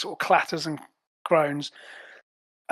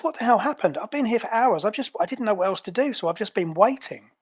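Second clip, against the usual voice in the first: higher-pitched, upset agent demanding answers; aggrieved and confused, pitched up; indignant Azure; 5 semitones higher than usual. Acted. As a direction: play a bewildered agent, voice raised in pitch.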